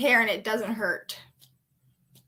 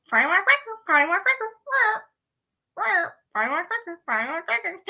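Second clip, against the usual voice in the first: parrot-like squawk; 8 semitones higher than usual. Acted. Silly repeated chant.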